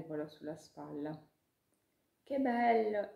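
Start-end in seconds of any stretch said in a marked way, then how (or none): 2.2-3.1 high, loud squeal of joy; a tiny creature thrilled to be the star; delighted ladybug. Acted. Ladybug to Chiara about being the heroine, high delighted squeal.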